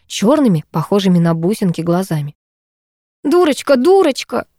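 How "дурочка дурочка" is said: repeating insistently. Acted. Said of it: "твердила Анаит".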